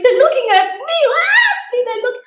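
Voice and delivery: high, loud mock sobbing complaint; panicked crying girl. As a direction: wail it like a terrified teen. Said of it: "She's like".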